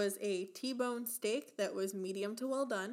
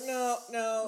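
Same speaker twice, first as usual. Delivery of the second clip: a hint of panicked pleading, louder; mock pleading; 2 semitones higher, 7 dB louder than usual. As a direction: imitate the crowd begging him to stop. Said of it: "they're like".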